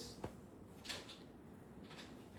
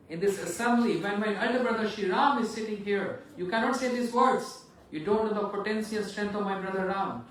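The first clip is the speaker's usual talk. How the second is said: loud, higher angry protest; angry rebuke; 5 semitones higher, 7 dB louder than usual. Acted. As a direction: defend his brother in rage. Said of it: "Lakshman so angry".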